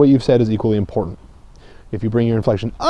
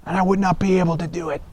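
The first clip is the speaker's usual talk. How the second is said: higher, much softer, strained croak; strained hoarse voice; 4 semitones higher, 8 dB quieter than usual. Shown as a demonstration. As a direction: talk like your voice is shot after a show. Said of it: "talking like this to people".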